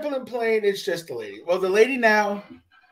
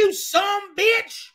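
mock bar shout; 14 semitones higher, much slower than usual. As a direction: bark it like a drunk ready to brawl. Acted drunk guy picking a fight.

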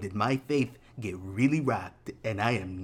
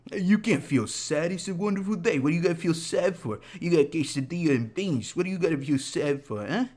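higher-pitched mock Italian-accented dad; exaggerated Italian-dad impression, pitched up; father with Italian accent; 5 semitones higher than usual. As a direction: scold like a baffled father in a put-on Italian accent. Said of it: "That's my father. I don't know why I do an Italian accent".

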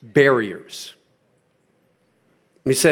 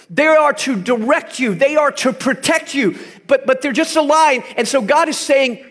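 raised, impassioned sermon voice, higher; full-force preaching; 7 semitones higher than usual. Acted. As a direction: preach it hard to the congregation.

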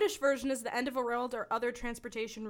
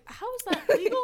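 high, loud indignant question; indignant disbelief; outraged disbelief; 11 semitones higher, 5 dB louder than usual. Retold or imitated. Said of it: "I was like".

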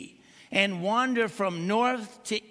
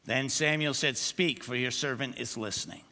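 ordinary talk

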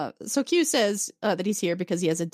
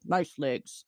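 a hint of a low Klingon grunt; low, deadpan compliment; lightly voiced Worf; 6 semitones lower, 4 dB quieter than usual. Worf remarking as Troi walks past, low deadpan. Worf's remark as she passes.

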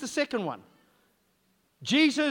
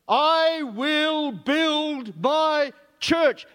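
very slow, drawn out, louder and higher; booming voice of Jesus; 4 semitones higher, 6 dB louder, much slower than usual. Acted. Preacher intoning Jesus' promise to the congregation, slow and booming. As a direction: proclaim each word like a grand declaration.

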